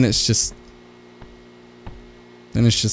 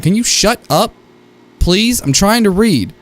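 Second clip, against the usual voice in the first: annoyed; 7 semitones higher than usual. Real, not acted. Annoyed at a ghost interrupting.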